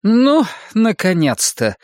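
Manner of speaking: high, drawn-out impatient greeting. A narrator's reading. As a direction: exclaim with pent-up impatience, pitched well up. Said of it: "нетерпеливо произнесла Мэйна Гладстон".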